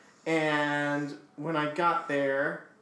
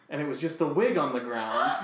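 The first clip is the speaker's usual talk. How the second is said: ordinary delivery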